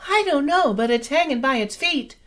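very high, chirping little bird voice; chirpy baby bird. Acted. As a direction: chirp it like a nestling. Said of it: "chirped Flitter".

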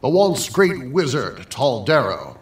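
ordinary talk

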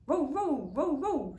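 high, loud barks; dog barking. A narrator's reading. Bottomley Potts barks at the yowling, high and loud. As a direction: bark like an excited dog. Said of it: "said Bottomley Potts".

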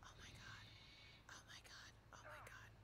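breathy unvoiced whisper. Real, not acted. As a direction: whisper it in shock. Shocked at noises.

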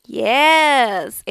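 ordinary talk, nothing marked

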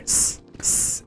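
unvoiced hissing sound; imitating spider hiss. Streamer recalling cave spiders, imitating their hiss. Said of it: "And you hear".

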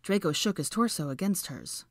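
usual speaking voice

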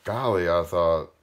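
emphatic, projected read-aloud; story narration. A narrator's reading. Reader telling of the diamond-hard flower, emphatic storytelling. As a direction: narrate with weight on the key words.

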